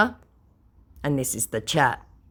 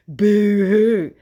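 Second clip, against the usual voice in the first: higher, louder put-on mock crying; mock boo-hoo; 7 semitones higher, 7 dB louder than usual. Real, not acted. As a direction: tease him with fake sobbing. Mocking the scammer.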